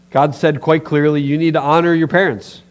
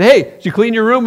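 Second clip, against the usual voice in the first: cheerful mom; 7 semitones higher, 8 dB louder than usual. Retold or imitated. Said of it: "your mom came to you and said".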